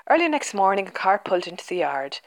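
plain reading voice

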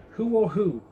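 put-on a young dinosaur asking the herd about his reflection, puzzled and high; wondering question. A narrator's reading. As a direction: ask in wide-eyed wonder. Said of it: "and he asked them".

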